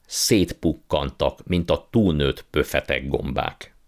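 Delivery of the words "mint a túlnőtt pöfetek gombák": usual speaking voice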